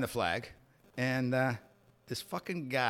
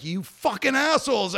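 high, drawn-out retold cursing; exasperated, amused outrage; mock outraged yell; 9 semitones higher, 4 dB louder than usual. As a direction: curse out your prankster friends. Retold reaction to the prank.

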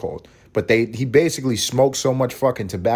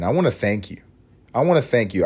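ordinary talk, nothing marked

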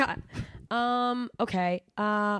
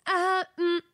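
higher, hesitant nervous bonjour; nervous French attempt; 8 semitones higher, slower than usual. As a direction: panic through the greeting. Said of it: "I panic".

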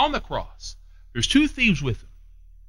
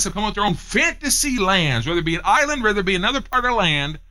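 raised, insistent pulpit voice; fervent preaching; 7 semitones higher, 5 dB louder than usual. Acted. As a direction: press the congregation hard and keep it up. Preacher at full force.

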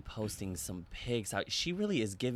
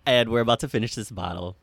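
tipsy and loud; giggly, wine-loosened voice; 9 dB louder than usual. Real, not acted. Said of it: "I'm a little tipsy".

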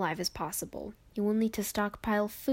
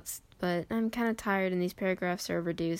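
ordinary talk

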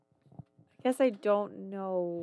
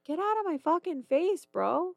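put-on raised, higher scolding of a toddler; 9 semitones higher, 8 dB louder than usual. Mom retells yelling at her roaring son. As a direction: tell the kid off, voice up. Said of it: "I was like".

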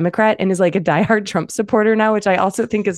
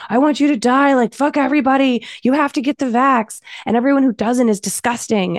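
higher-pitched mock rant; hammed-up rant, lightly raised; mock hateful ranting; 6 semitones higher than usual. Media quoting hateful vaccine ranters, mock rant. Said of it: "people are out there saying".

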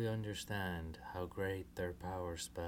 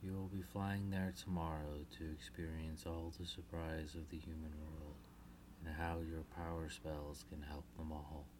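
soft, low reassuring mother; comforting; 12 semitones lower, 15 dB quieter than usual. Acted. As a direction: hush and soothe the little ones. Queen Selena reassures daughters.

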